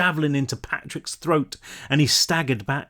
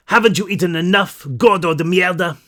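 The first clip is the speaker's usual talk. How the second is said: a fierce woman's jeer, a little louder; 4 semitones higher, 5 dB louder than usual. Acted. Knight taunting the monster, fierce jeer. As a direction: taunt the brute in a Spanish-tinged voice.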